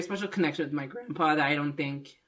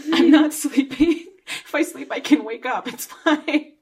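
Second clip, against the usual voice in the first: laughing protest; 9 semitones higher, 6 dB louder than usual. Retold or imitated. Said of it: "It's like".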